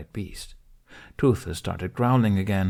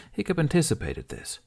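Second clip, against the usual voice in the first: usual speaking voice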